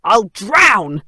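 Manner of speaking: loud, panicked cry; a mock panicked yelp; distressed mouse. A narrator's reading. Mouse fears drowning in the lake, loud mock panic.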